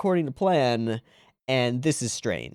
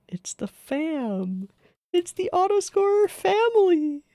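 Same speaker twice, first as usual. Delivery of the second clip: high excited squeal; slower than usual. Real, not acted. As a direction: squeal with fan delight. Excited reveal of returning characters.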